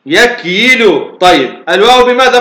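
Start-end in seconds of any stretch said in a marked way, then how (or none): 0.1-1.1 يكيل very slowly as yak-yi-lu; original form. Shown as a demonstration. Teacher showing the original form, يكيل slowly split as yak-yi-lu. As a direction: stretch يكيل out syllable by syllable. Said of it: "يقول أصلها يقول".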